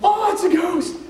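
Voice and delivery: high, laughing mock cry of terror; mock terrified cry. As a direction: squeal it like scared schoolkids, half laughing. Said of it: "So they're like".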